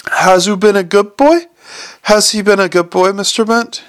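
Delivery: put-on high cooing baby talk; doting old lady baby-talking her dog. Cooing over the little dog.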